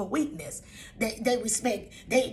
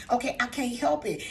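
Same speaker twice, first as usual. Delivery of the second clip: usual speaking voice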